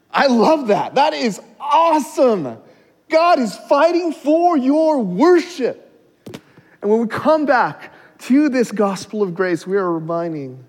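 high, loud exclamations of praise; impassioned preaching. Real, not acted. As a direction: preach at full force, then start easing down. Preaches at full force.

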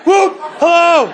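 high loud whoop then hello; whooping glee again. Acted. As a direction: throw out a gleeful whoop. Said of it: "brought a little Bruce in there".

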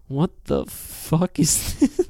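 slow, baffled question breaking into laughter; drawn-out disbelief. Retold or imitated. Imitating the test audience's reaction, slow laughing disbelief. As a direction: stretch each word in mock bewilderment.

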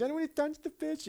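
high, soft mincing whine; mock a prissy little dude who won't touch fish; hammed-up dainty squeal; prissy whiny voice. Mocking prissy disciple voice.

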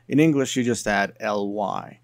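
ordinary talk, nothing marked

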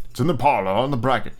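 plain reading voice

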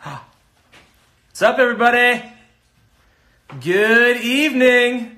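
loud, slow showman greeting; in front of an audience. Acted. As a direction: greet the crowd like a host. Host greeting live stream viewers.

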